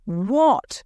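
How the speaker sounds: a loud outraged single-word shout. Acted. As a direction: bark out the word in disbelief. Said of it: "Monsieur Armand shouted".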